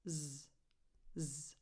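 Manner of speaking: soft, low isolated "z" buzz; quiet buzzing "z", held briefly; Z sound. Shown as a demonstration. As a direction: voice the "z" alone, not an s. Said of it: "A Z sound, not a s".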